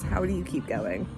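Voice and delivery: ordinary talk, nothing marked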